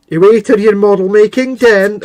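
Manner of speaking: high, loud mock Scottish order; Scottish accent. Host plays wife banishing husband, loud mock Scottish accent. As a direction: send him off in a hammed-up Scottish accent. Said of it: "getting spoken to in Scottish accent".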